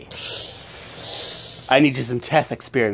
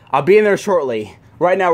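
ordinary talk

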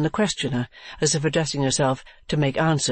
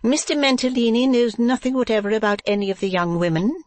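high-pitched proprietress; Madame Mantalini; 9 semitones higher, 3 dB louder than usual. Acted. Wife addressing the new girl, high character voice.

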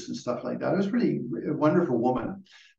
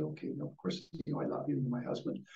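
much softer, retold reassurance; gentle forgiveness; 3 semitones higher, 10 dB quieter than usual. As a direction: voice a wife softly forgiving her husband. Wife forgives her sick husband.

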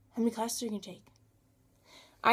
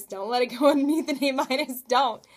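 louder, laughing through the words; laughing plea; 4 semitones higher, 5 dB louder than usual. Retold or imitated. Praying for her grade, laughing plea. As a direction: beg with a laugh at yourself. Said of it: "I'm like".

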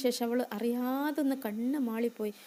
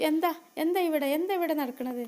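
alarmed demand; 5 semitones higher, 4 dB louder than usual. Acted. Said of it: "ഉമ്മ ചോദിച്ചു".

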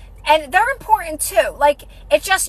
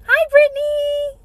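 high, slow drawn-out hello; sing-song greeting; 12 semitones higher, slower than usual. Real, not acted. Greeting a live viewer.

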